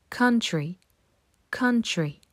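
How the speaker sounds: "Country" with a short vowel between a and o; model pronunciation. Shown as a demonstration. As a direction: say "Country" as kan-tri, neither ou nor ao. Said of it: "Répète avec moi". (prononcer "Country" avec un son entre A et O)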